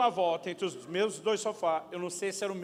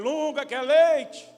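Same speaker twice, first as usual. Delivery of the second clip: wife asking; 8 semitones higher, 11 dB louder, much slower than usual. Retold or imitated. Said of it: "a mulher do Lunga uma vez perguntou".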